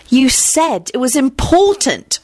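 slow, high accusing tone. A narrator's reading. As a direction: spell it out like an accusation. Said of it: "she announced accusingly".

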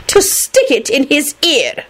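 slow, high-pitched scorn; haughty. A narrator's reading. Minerva finishing her retort for Sirius, haughty. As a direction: draw out the retort loftily. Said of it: "she replied haughtily".

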